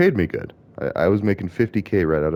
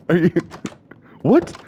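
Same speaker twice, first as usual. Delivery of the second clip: much higher, a laugh breaking into what; laughing disbelief; 12 semitones higher than usual. Real, not acted. Mocking the severance offer to viewers, laughing in high disbelief. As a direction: laugh it out, can't believe it.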